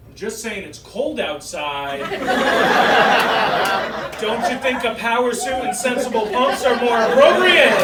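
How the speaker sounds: dad turning fashion critic; hammed-up sheepish dad; dad backpedalling. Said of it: "he said".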